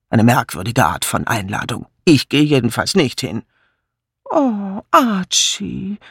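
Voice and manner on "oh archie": high plaintive whine; whining. Acted. Wife whining to her husband, high whine. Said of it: "stieß Misses Easterbrook jammernd hervor".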